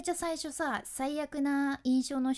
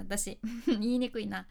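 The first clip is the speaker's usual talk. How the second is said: ordinary talk, nothing marked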